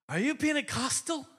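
put-on high-pitched Irish accent; imitated Irish lilt, pitched high. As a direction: imitate a curious Irishman asking. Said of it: "He's got this great accent".

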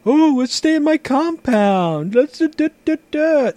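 mock the players in a high whine; mocking whiny player voice. Mocking players who stay put.

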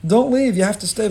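high, loud plea; Moshe pleading. Acted. Moshe urging Yisro not to leave, high and loud. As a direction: insist he stay, voice lifted high. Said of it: "Moshe is telling his father-in-law".

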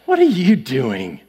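low sarcastic question after a puff of breath. Shown as a demonstration. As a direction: drop the pitch and lean on "are you". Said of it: "It could be sarcastic".

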